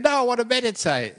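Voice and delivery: high, loud protest as a big prisoner; loud, high-pitched quoted outburst; emphatic insistence. Retold or imitated. Acts out huge prisoner insisting.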